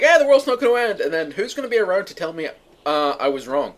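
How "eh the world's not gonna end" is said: high, loud, breezy brush-off; dismissive shrug. Retold or imitated. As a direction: toss it off like it's obvious. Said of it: "I'm like".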